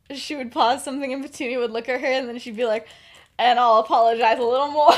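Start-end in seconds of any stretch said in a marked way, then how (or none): 3.4-4.9 louder, laughing send-up; laughing mockery. Sister mocking Lily's apology, laughing loudly. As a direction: laugh through the line.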